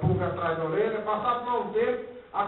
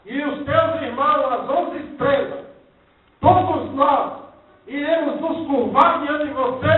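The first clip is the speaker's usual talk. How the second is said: a hint of an angry father scolding, higher; 5 semitones higher, 4 dB louder than usual. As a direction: scold the dreaming son. Angry father Jacob scolds son. (pai irritado repreendendo o filho)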